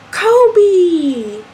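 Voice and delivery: high, drawn-out call across a distance. Retold or imitated. Girl outside the house hollering for her friend, calling out. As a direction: call his name long, pitch sliding down.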